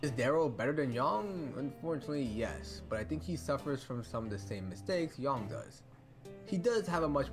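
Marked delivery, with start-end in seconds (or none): none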